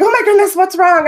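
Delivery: high, loud alarmed cry; alarmed mom. Retold or imitated. Mom hearing her crying son, high loud alarm. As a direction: rush in fearing the worst. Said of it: "And so I'm like".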